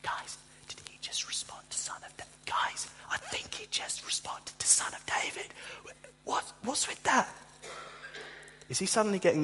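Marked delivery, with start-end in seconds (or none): 0.0-7.3 much softer, hushed astonished whisper; disciples whispering. Retold or imitated. Disciples muttering amazed to each other, whispered. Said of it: "you can imagine the disciples".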